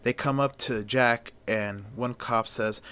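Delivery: plain reading voice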